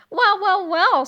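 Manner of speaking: drawn-out smug triple well. Acted. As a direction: savor each well, pleased with yourself. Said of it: "said Warden Schmorden smugly".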